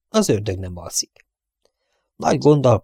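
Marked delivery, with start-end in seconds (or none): 0.1-1.3 whispered. A narrator's reading. Narrator's ominous aside.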